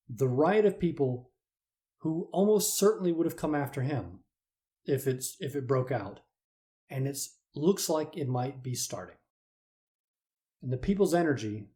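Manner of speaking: ordinary talk, nothing marked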